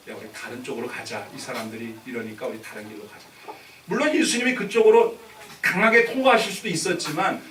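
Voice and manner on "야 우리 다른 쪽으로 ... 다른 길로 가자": low, soft acted suggestion; quietly steer the group elsewhere; calm leading away. Jesus calmly leads disciples away.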